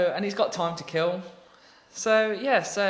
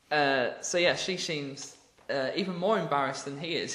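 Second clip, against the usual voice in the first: ordinary delivery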